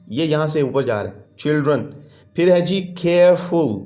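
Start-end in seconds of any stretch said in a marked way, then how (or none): 2.8-3.7 careful in two beats, care / ful; split into syllables. Shown as a demonstration. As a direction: sound out both parts of careful. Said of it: "टुकड़ों में है".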